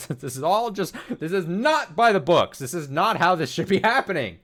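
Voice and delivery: loud, high indignant outburst; outraged. Real, not acted. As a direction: protest loudly, in disbelief. Outraged at the plot.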